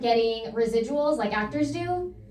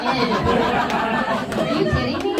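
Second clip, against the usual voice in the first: incredulous drawl; 3 dB louder, much slower than usual. Acted. Incredulous drawn-out question.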